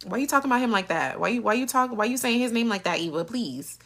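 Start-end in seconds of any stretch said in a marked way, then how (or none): none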